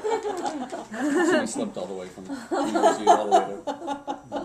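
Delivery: high laughing explanation, slow. Real, not acted. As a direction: laugh while explaining the punchline. Laughing through explaining joke.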